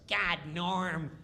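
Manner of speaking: high, nagging needling voice; imitating Kattan's high taunting nag; mocking Kattan. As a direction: pick at Norm in a high whine. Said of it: "Kattan starts".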